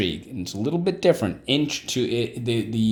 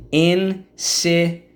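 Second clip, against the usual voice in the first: drawn out; 7 semitones higher, 4 dB louder, much slower than usual. Shown as a demonstration. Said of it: "Okay, so let's go".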